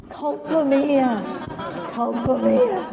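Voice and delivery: slow, drawn-out Italian plea of guilt; a hint of the begging Italian monk; Italian penitent. Monk crawling up the aisle, slow Italian plea. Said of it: "crawling up the aisle".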